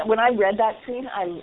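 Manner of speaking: ordinary talk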